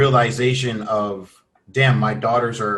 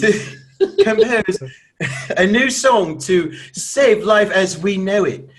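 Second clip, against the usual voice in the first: mock British reading, laughing; laughing British accent; 6 semitones higher, 5 dB louder than usual. Shown as a demonstration. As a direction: laugh into a posh English compose. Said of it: "wait, wait, wait. I'm sorry".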